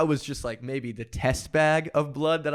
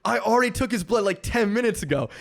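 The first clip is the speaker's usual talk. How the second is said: retold yelling; 7 semitones higher than usual. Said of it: "they're, like, yelling at each other".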